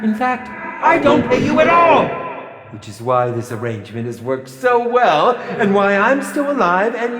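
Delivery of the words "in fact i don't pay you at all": triumphant shout. Acted. Director berating actor.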